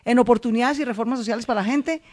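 high, forceful campaign call; rally the crowd to go and vote; ringing stump-speech climax; rousing rally finale. Pretend. Rousing close of the requested speech.

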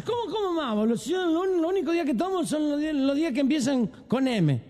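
put-on high, indignant drunk; drunk protest.

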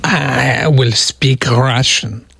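slow, low mock Russian accent, half-hearted; a hammy Russian accent that misses; bad Russian accent. Radio host trying his Russian accent again, a bad mock Russian accent. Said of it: "it still doesn't sound right".

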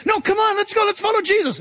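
high, eager push to go; rally the doubters; urging Peter. Retold or imitated. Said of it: "Peter urging the others".

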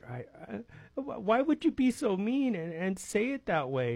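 high, hesitant mock-hurt whimper; mock crying. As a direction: act wounded and tearful, without real sobs. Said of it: "starts crying".

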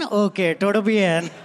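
put-on Spanish in a light Indian accent; casual Indian-accented reply. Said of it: "speaking Spanish in an Indian accent".

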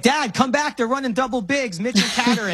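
put-on high-pitched, urgent kid calling for dad; imitating excited son. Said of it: "my seven-year-old".

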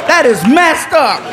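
slow, drawn-out mock grievance; retold comic concession. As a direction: imitate a friend reluctantly delighted. Pranked friend conceding, then protesting.

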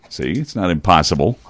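normal talking voice

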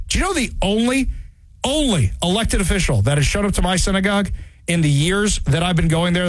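usual speaking voice

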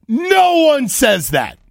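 high, loud, drawn-out NO; emphatic scorn. Real, not acted. Host picking apart the caller's tell, loud exasperation. Mocking the caller's odd phrase.

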